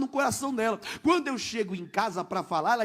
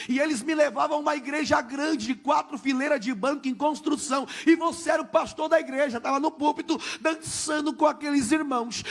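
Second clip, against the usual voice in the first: excited shout; 4 semitones higher than usual. Retold or imitated. Retelling wife's dream to the church, excited shout.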